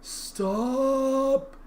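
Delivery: drawn-out high river spirit; slow booming river voice. Acted. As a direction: speak as an ancient river, stretched slow.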